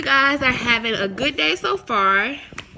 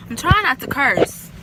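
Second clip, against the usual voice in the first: usual speaking voice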